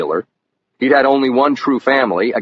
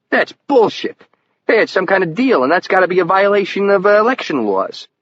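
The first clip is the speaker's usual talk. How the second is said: much higher, indignant outburst; outraged; 8 semitones higher than usual. A narrator's reading. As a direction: burst out outraged, pitch jumping up. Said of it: "Levin looked outraged".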